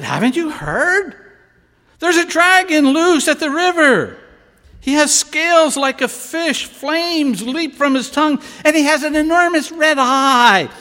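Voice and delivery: high, loud rush of news; breathless excited shout. Acted. Townsman at the window calls to Zellman, loud excited shout. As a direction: yell it like the dragon is real.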